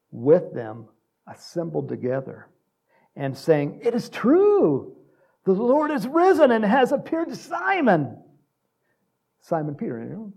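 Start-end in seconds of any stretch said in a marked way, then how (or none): 3.8-8.2 loud, high, stretched-out proclamation; exultant announcement. Acted. Preacher as disciples announcing the risen Lord, exultant. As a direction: cry out the amazing news.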